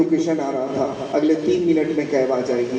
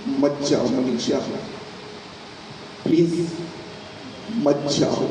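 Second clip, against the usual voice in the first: tearful plea; 4 dB quieter than usual. Acted. Husband with tears in his eyes begs wife, tearful. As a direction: beg weakly, broken up by pauses. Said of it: "उसकी आंखों में आंसू था".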